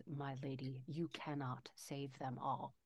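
breathy whispered warning, barely voiced. Tariel whispering a warning to Eowyn. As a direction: whisper it to her face, unblinking. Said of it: "Tariel speaks to Eowyn, whispered".

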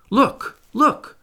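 high, loud exclamations; full of excitement. A narrator's reading. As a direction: cry out like a lookout spotting prey. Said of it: "his voice full of excitement".

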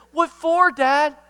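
put-on high, loud balking kid; questioning protest. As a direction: push back on Dad's order. Son's imagined questioning reply.

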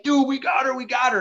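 high-pitched, thrilled celebration, replayed; retold triumph. Angler to buddy as fish is netted, retold high excitement. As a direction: relive the moment the catch is landed.

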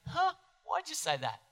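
high-pitched, questioning mock bafflement; puzzled. Imagined listener questioning the preacher, puzzled.

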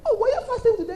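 high-pitched mock astonishment; hammed-up clueless surprise; acted surprise. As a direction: squeak it like you had no idea. Plays a member who didn't know.

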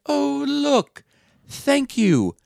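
put-on high, sarcastic old lady thanks; mock fake gratitude. Retelling The Ref, the old grandmother thanking family for a cheap purse, high sarcastic voice. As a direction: gush falsely like a snobby grandmother.

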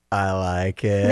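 low, slow take on a daughter; lightly voiced daughter, drawn out slowly; imitated daughter. Parent quotes the daughter's reaction, lightly imitated, low and slow. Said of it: "she looks at me and she goes".

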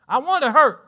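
high, loud preacher's cry; preacher cries out to wound the congregation; emphatic shout. Acted. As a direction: hit it hard and loud, like a blow.

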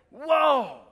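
loud, high burst of astonishment; acted awestruck exclamation; amazed whoa. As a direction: let it out as if floored. Awed reaction to reading scripture.

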